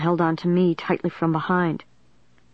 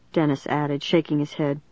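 ordinary delivery, nothing marked

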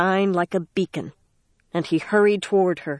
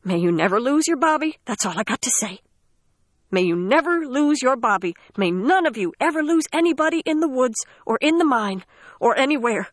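cracking tearful heartbreak; 6 semitones higher than usual. Acted. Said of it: "said through her tears".

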